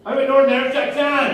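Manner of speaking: high, loud proud declaration; mock proud shout. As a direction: bellow the new goal proudly. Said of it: "Then my brain went".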